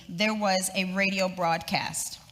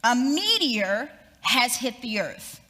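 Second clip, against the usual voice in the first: higher, urgent newsreader voice; urgent news bulletin; 4 semitones higher than usual. Retold or imitated. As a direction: break in with urgent radio news. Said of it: "this urgent bulletin continued".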